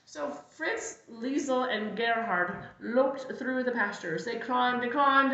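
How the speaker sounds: usual speaking voice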